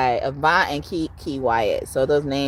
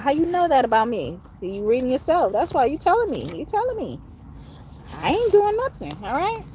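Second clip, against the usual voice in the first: playful accusing voice, climbing higher; teasing; 9 semitones higher than usual. Acted. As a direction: tease them, voice rising and rising. Mock teasing accusation, rising.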